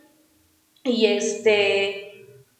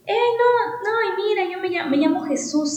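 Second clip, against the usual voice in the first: friendly guy; 7 semitones higher than usual. Retold or imitated. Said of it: "el chavo fue así como".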